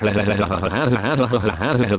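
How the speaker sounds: breathy mock villain cackle; hammed-up wicked laugh; evil cackle. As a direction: cackle like a gleeful cartoon villain. Cackling over his own villain boast.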